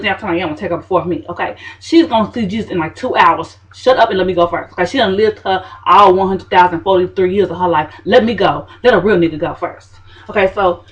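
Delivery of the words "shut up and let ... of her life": normal talking voice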